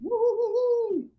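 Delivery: high cheering whoop; excited whoop. Real, not acted. Jury cheers for judge.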